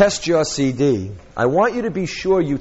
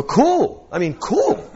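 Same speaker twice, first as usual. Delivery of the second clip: cheerful fake enthusiasm; mock cool; 10 semitones higher, much slower than usual. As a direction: overdo the cool, pretending to be impressed. Feigned praise to client.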